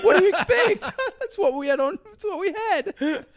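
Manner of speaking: very high, helpless laughing voice. Real, not acted. Cohost riffing on the witches' masks, laughing. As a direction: squeal it through the giggles. Both cracking up over masks.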